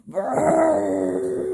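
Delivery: water spout noise. Pretend. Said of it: "he goes".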